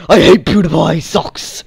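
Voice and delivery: loud, high mock outburst; mocking imitation. Teasing the friend with his own old opinion, loud mocking voice. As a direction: yell it back at your friend in a put-on voice. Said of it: "you're the one who's like".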